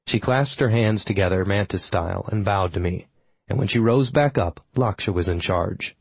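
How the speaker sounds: ordinary delivery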